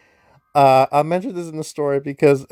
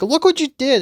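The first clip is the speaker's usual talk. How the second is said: much higher, mock-upset cry; a retold wail of frustration; upset accusation; 13 semitones higher, 4 dB louder than usual. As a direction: throw the blame at Clarissa. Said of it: "so upset with Clarissa".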